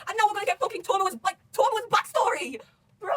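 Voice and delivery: high, drawn-out hype shout; loud excitement. Real, not acted. Fan announcing a long-awaited backstory, loud excited shout. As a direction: build to an ecstatic shout. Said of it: "I am a really loud person, I'm excited".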